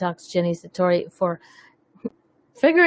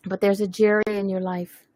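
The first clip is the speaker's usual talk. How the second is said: usual speaking voice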